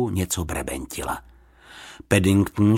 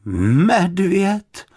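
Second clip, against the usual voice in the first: doubtful; 10 semitones higher, 4 dB louder than usual. A narrator's reading. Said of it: "opakovala paní Ptáčková pochybovačně".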